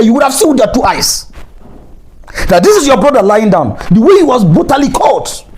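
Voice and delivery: real anger rising at supporters of the government; angry. Real, not acted. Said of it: "I'm just being angry".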